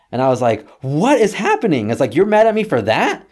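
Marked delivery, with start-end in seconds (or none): 0.8-1.9 high-pitched acted bafflement; astonished raised voice; incredulous. Acted baffled reaction to dad. 2.1-3.3 high, loud rising question; mock-astonished comeback; incredulous. Acted baffled reaction to dad.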